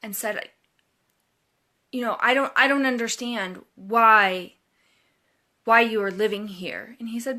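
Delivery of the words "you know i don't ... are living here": raised, louder accusing voice; retelling an angry neighbor's challenge; retold angry confrontation. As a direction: confront him loudly, half-acting the anger. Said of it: "came to him very angrily".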